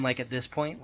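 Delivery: usual speaking voice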